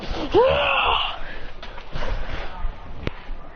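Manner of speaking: high scream at held-back volume; strained high battle yell; acted battle scream. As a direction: cry out mid-fight, trailing away. Hero screams in galactic fight.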